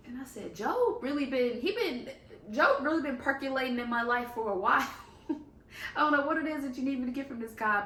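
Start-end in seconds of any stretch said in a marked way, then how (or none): 0.5-4.9 higher and louder, amazed; pitched up in delighted disbelief; excited realization. Retold or imitated. Reading his vision board, excited and loud. 4.9-5.9 short airy laugh; a quiet disbelieving chuckle; breathless laugh. Real, not acted. Laughing at the Job coincidence on his vision board, breathless. 6.0-7.8 talking fast through laughter; laughing. Real, not acted. Puzzling over what to learn from Job, laughing fast.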